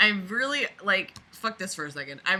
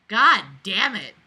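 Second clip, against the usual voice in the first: louder, slow god damn it; exasperated groan; 2 semitones higher, 11 dB louder than usual. Real, not acted. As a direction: drag it out in frustration. Exasperated at the dialogue.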